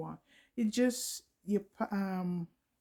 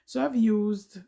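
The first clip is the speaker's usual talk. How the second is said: frog in throat; 3 semitones higher, 8 dB louder than usual. Real, not acted. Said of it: "I think I got a frog in my throat".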